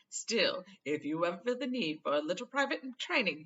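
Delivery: loud, slowish showman bragging; boastful Lockhart. Acted. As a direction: preen about his past.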